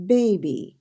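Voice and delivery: BA-by, first syllable loud, falling after; "baby" front-stressed for a learner. Shown as a demonstration. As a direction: hit the BA of "baby" firmly. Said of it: "The accent is on the first syllable".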